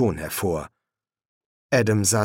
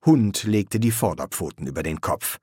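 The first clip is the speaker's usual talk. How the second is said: usual speaking voice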